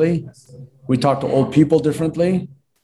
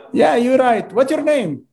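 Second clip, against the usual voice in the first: Romanian client; 10 semitones higher, slower than usual. Retold or imitated. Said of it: "she's Romanian".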